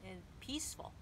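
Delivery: normal talking voice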